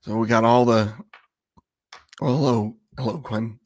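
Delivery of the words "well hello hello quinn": usual speaking voice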